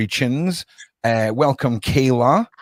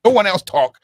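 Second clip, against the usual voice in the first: half-joking snap, higher and a bit louder; mock angry outburst; 6 semitones higher, 4 dB louder than usual. Host mocking his own impatience with guests, mock-irritated. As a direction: play at being fed up with the chatter. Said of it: "'cause I'm just like".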